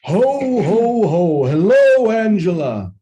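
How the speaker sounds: big booming Santa call, pitched high; Santa Claus. Retold or imitated. Dad dressed as Santa greets his daughter, loud Santa Claus voice. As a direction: belt out a loud, drawn-out jolly ho-ho-ho greeting. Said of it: "in my loudest Santa Claus voice".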